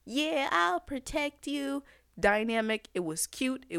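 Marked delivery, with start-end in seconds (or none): 0.0-1.8 a hint of a child, higher and slower; little kid voice. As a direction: lightly play a brave little boy.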